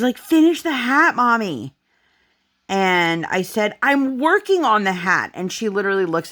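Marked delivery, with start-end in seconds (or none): 0.2-1.8 high-pitched imitation of a demanding little kid; mock toddler yell, pushed up high; yelling child. Daughter pressing mom about the unfinished hat, yelling. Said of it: "is yelling at me". 3.8-5.3 exasperated retort. Retold or imitated. Retold quarrel with demanding daughter.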